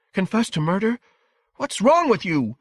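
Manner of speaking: high, loud outraged challenge; angry mother. A narrator's reading. Wife defending her son against her husband, furious. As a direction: build to an indignant burst. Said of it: "her face pale and angry".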